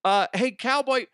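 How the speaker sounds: mock host voice. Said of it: "he goes".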